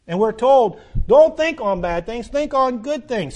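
loud, high commands to the congregation; full-force preaching. Real, not acted. Preacher exhorting listeners to purify their minds, loud and high. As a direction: drive each command home hard.

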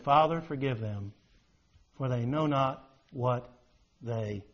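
low and soft, fading to almost nothing; hushed quote of Jesus. Retold or imitated. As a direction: murmur it as a dying man's plea. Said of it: "He said".

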